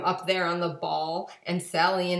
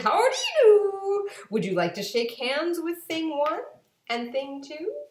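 much higher, louder, chirpy; chirpy Things; 11 semitones higher, 6 dB louder than usual. A narrator's reading. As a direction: greet in a high sing-song. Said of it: "They said".

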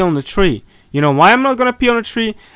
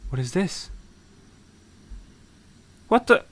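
normal talking voice

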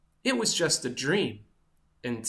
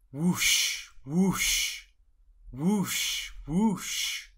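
repeated whooshes; much slower than usual. A narrator's reading. Wing flapping sounds.

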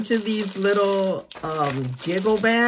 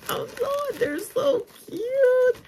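excited gush. Real, not acted. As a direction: coo over them, much higher. Gushing over cute tags.

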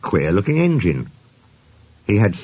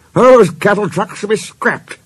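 high, loud indignant outburst; fuming; 11 semitones higher, 5 dB louder than usual. A narrator's reading. Sir Handel complains about the coaches, fuming. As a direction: fume at full pitch about useless trucks. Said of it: "he fumed".

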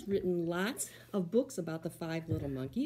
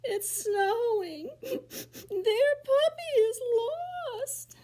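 slow, much higher hammed-up crying; mock weeping; 6 dB louder, much slower than usual. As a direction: wail over the lost puppy. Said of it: "She's feeling so bad".

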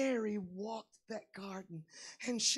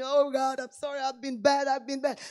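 guilty sinner; 4 semitones higher, 12 dB louder than usual. Acted.

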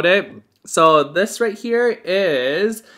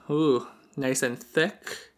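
normal talking voice

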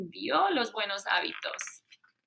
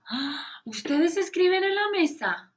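ordinary talk, nothing marked

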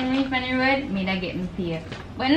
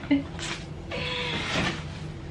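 high squeaky laugh; 13 semitones higher, 9 dB quieter than usual. Real, not acted. Annoyed about the late money.

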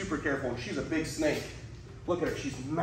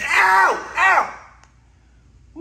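high loud yelps of pain; 10 dB louder than usual. Real, not acted. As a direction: cry out in sudden pain. Stretchy pants, crotch hurts.

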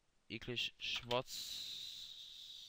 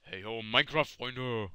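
Gomme impression; 2 semitones lower, 6 dB louder than usual. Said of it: "Ja, das war der Gomme".

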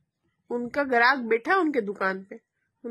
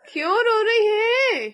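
drawn-out, higher-pitched coaxing; coaxing question; 6 semitones higher, slower than usual. Real, not acted. Mother asks crying child.

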